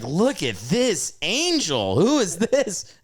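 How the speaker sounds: high, drawn-out gushing over a beauty; smitten amazement. Retold or imitated. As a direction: marvel with big swooping pitch. Acts out being smitten onscreen.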